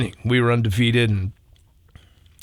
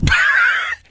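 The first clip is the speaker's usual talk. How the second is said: burst of laughter; 4 dB louder than usual. Real, not acted. Punchline lands, he cracks up.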